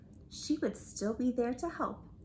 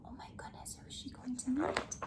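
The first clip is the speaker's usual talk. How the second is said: breathy suspense whisper, quicker; 7 dB quieter, much faster than usual. Acted. Reader building suspense for listeners, whispering. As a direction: lean in and whisper the question.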